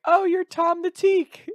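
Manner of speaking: high-pitched, loud mock squeal; excited girl impression. Girl spotting a guy outside the dorms, high mock squeal. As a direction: draw it out in a high, gushing girl voice. Chick spots him, voiced by teller.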